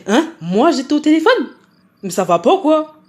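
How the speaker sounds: hein aigu, indignée et forte; outrée, la voix qui monte; indignation surprise. Retold or imitated. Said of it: "Je fais". (elle s'indigne, incrédule, plus fort et plus aigu)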